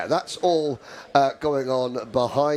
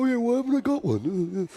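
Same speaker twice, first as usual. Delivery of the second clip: realising driver voice; 10 semitones higher than usual. Retold or imitated. Voices driver realising his mistake.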